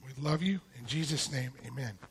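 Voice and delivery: ordinary talk, nothing marked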